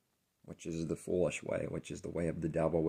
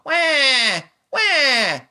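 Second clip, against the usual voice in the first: put-on very high, loud baby wail; mock baby crying at full volume; pretend real cry; 18 dB louder than usual. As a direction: bawl "wah" like an infant, hammed-up. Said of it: "That was real".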